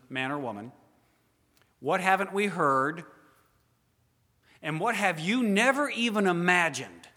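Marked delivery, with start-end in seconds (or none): 1.8-7.1 rising preaching. Real, not acted. Preacher presses rhetorical questions.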